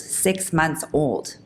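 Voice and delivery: ordinary talk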